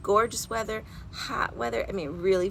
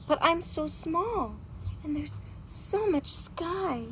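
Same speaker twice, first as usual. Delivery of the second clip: scared; 10 semitones higher, slower than usual. Acted. Robin scared of flying.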